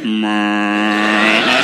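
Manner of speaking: low growl noise. Retold or imitated. Angry shop assistant growling at comedian, low mock growl. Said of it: "made a noise that was unusually low".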